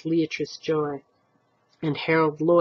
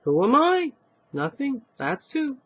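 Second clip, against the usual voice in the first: put-on smug old man; 9 semitones higher than usual.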